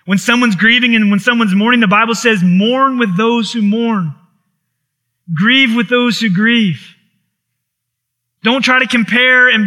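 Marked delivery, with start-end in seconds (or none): 2.4-7.0 slow, weighted quote; slow scripture. Acted. Preacher quoting the Bible on grieving, slow and weighty. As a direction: draw out each word.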